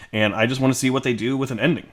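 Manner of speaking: usual speaking voice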